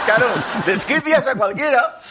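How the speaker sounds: laughing. Real, not acted. Exasperated laughing exclamation.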